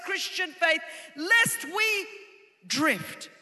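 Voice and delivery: loud, emphatic sermon delivery; urgent preaching. Real, not acted. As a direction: press the warning on the congregation.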